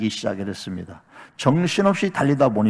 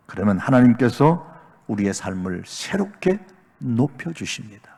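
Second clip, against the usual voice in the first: ordinary talk, nothing marked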